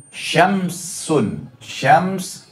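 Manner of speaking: "Syamsun, syams" with the first letter shin and the second sin; shin then sin. Shown as a demonstration. Teacher to congregation, demonstrating shin then sin. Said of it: "Beda sin dengan shin".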